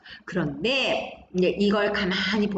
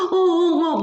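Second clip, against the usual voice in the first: loud, high-pitched pretend wail; mock crying; 8 semitones higher, 5 dB louder, slower than usual. Teacher shows a pupil openly crying, mock sobbing. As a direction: fake a sobbing "uh, uh". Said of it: "막 우는".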